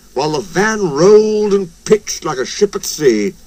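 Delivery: plain reading voice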